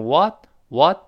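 WHAT with a wide-open American "ah"; American-accented WHAT, open "waht"; American pronunciation. Shown as a demonstration. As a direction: say WHAT the American way, mouth bigger. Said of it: "美国读成what，what口型大一些".

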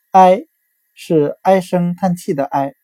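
ordinary talk

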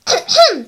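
loud, deliberate ahem; throat clearing. A narrator's reading. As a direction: clear the throat before a lecture. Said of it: "小依依清了清嗓子".

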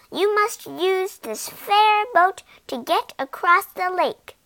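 a small bossy insect insisting; mosquito voice. A narrator's reading.

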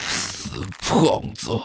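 hissed under the breath; whispered curse. Acted. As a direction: curse him quietly behind his back. Muttered curse at his back. (压低声音咬牙咒骂)

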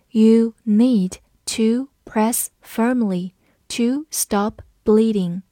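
very measured, slow word-by-word reading; slow read-along. Shown as a demonstration. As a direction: take it slowly, pausing between words. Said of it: "跟着我慢读一遍". (一词一顿，慢速领读)

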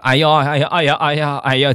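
acted wailing after a tumble; crying out in pain. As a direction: imitate a fallen old man's repeated yelps. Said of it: "叫唤".

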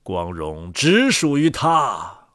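ordinary delivery, nothing marked

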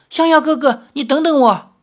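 high, loud call from behind. A narrator's reading. Anu calling after Xiaoyao from behind, loud high call. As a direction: shout after him to wait. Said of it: "传出阿奴的叫声".

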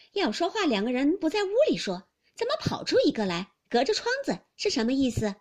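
high, loud questioning; suspicious mistress Fengjie. Acted. Mistress catching maid talking through the window, loud suspicion. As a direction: challenge them sharply from the yard.

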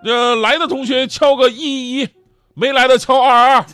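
high, loud classroom teacher. Acted. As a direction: call the class to order like a teacher. Teacher taking online roll call.

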